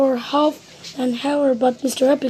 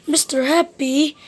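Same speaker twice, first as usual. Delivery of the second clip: plain reading voice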